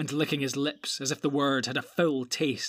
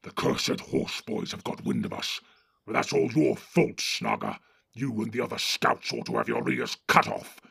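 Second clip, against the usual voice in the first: gruff orc chief giving orders; Ugluk the orc captain; 3 semitones lower than usual. Acted. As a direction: bark orders at the cowardly Northerners. Ugluk blames Snaga.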